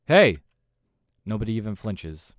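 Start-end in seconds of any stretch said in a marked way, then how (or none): 0.1-0.4 loud, high shout; sharp attention-grabbing yell; calling out. Acted. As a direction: shout to make them look. Server shouts at ignoring staff.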